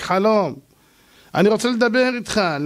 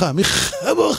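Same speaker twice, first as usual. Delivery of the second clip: choked, strangled words; choking; much slower than usual. Acted. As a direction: force it out as if water fills the throat. Pharaoh choking on sea water.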